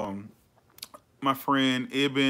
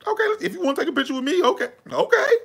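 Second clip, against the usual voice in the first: eager agreeing; 4 dB louder, faster than usual. Pretend. Acting out agreeing to a photo.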